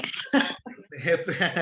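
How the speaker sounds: short chuckle; amused laugh. Real, not acted. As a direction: laugh briefly at the interruption. Teacher amused by student's sudden reply. (risada curta e divertida)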